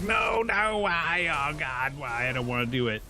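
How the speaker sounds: high wailing mock protest, easing down as it goes; mock anguished protest. Reviewer acts out Kratos refusing his destiny, hammed-up anguished wail. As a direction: wail it like an over-the-top tantrum. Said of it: "he's just completely pissed off".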